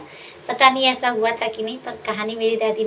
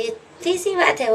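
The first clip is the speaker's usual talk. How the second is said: lightly voiced bird; 7 semitones higher than usual. Bird reassures crying girl.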